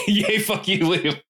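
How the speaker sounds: high giggly mock insult; laughing. Real, not acted. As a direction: laugh through a teasing curse. Jokey insult while laughing.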